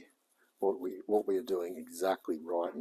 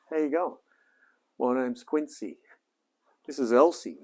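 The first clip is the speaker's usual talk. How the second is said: cheery intro; 5 semitones higher, 5 dB louder than usual. Acted. On-camera intro to the car.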